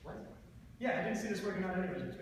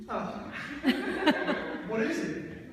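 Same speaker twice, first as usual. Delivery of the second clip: laughing; 9 semitones higher, 9 dB louder, slower than usual. Acted. Laughing then asking.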